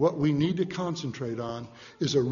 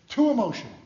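higher, louder scoffing repeat; indignant echo; 7 semitones higher, 5 dB louder, faster than usual. Real, not acted. Preacher repeating the critics' charge, indignant and louder. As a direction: throw the accusation back, offended. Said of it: "you're too emotional".

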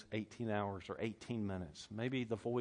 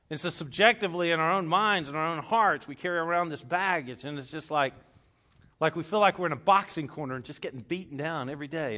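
1 loud preaching; 3 semitones higher, 7 dB louder than usual. Real, not acted. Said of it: "preaching at full force".

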